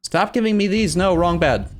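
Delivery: high-pitched, exasperated protest; exasperated scolding. Real, not acted. Player telling the game to stop, exasperated scolding. Scolding the game's unwanted events.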